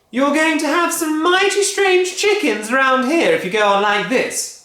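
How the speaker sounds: high, loud scolding wife; Missus Cranky nagging. Acted. Missus Cranky scolding husband, high nagging voice. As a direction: nag him, sure he'll fail. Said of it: "she said".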